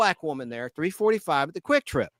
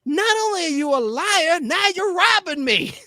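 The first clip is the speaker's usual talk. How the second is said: much higher and louder, slowing on the last words; indignant customer; 11 semitones higher, 4 dB louder than usual. Retold or imitated. Woman demanding rolling papers from the clerk, high indignant voice. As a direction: play the outraged lady, pitched way up.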